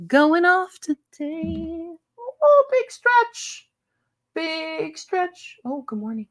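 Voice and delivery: sing-song high pet voice; puppy talk. Real, not acted. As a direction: coo to a waking puppy. Cooing to waking puppy.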